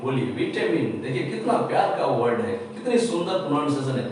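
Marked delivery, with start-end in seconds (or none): 0.4-1.0 विटामिन said soft and low, light even stress. Shown as a demonstration. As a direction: say विटामिन gently without punching any syllable. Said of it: "English को लो stress में बोलिए". (लो stress में विटामिन)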